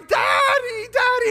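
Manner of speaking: put-on very high, loud child's scream for Daddy; piercing high cry for a parent. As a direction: imitate a terrified child screaming out. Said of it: "looking at me screaming".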